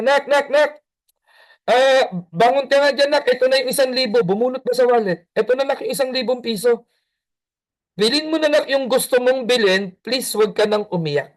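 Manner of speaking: a hint of an apologetic dad; coaxing father. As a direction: coax the crying child out of bed. Father coaxing crying son.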